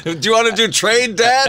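high laughing tease. Acted. Teasing, voicing the son.

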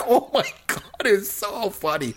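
slow, high words through laughter; gushing between laughs; laughing. Real, not acted. Laughing recalling the scene.

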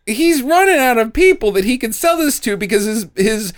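high, laughing commentary; amused. Real, not acted. Mocking the villain's business sense with laughter. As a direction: chuckle while explaining.

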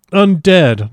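shocked echo, pitched high and loud; aghast repetition. A narrator's reading. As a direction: cry it out in disbelief. Arthur echoes the word in shock.

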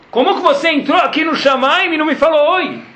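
voz bem mais aguda, de cobrança indignada; Hashem indignado. Retold or imitated. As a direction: Deus repreendendo, espantado. (Hashem repreende Moshe, em tom indignado e agudo)

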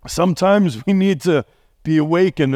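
ordinary talk

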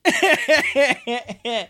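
real giggle trailing off. Real, not acted. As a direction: laugh it out and fade. Laughing at own repeated flub.

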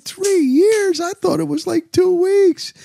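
high, incredulous replay of his own surprise; mock astonishment, stretching out two weeks slowly; retold disbelief. Telling bar friends how shocked he was, high mock disbelief.

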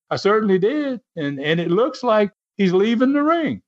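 plain reading voice

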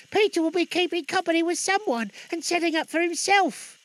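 put-on very high, piping girl's voice; teasing girl. Daughter teasing her brother Peter, high and piping. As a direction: tease in a high girlish sing-out. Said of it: "cried one of the girls".

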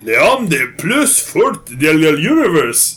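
slow, halting phrasebook French; terrible French. Shown as a demonstration. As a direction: fake a bad French accent, slowly. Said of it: "That's my terrible French".

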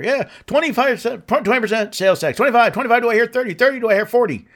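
high, rapid auctioneer patter. Retold or imitated. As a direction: rattle off bids like an auctioneer. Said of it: "if they sound like an auctioneer".

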